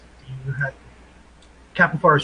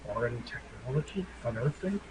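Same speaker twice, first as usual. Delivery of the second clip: hushed, much softer questioning; quiet aside; 3 semitones lower, 14 dB quieter than usual. Acted. Player turns to Max with a question, quiet aside. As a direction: say it low-key, for one companion only. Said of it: "kind of quietly say".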